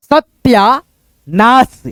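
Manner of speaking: सत्यानाश stretched slowly, syllable by syllable; slow syllable demo. Shown as a demonstration. Teacher sounding out सत्यानाश for students, slowly. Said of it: "देखो यहाँ पे".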